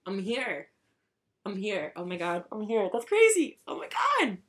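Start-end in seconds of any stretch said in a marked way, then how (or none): 2.9-4.4 high, squealed amazement; overwhelmed squeal; amazed. Real, not acted. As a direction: exclaim in a high burst of disbelief. Overwhelmed that she is here.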